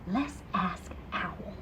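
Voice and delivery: slow hushed whisper; soft secretive whisper. Acted. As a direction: whisper the idea slowly. Pearl suggests asking Owl.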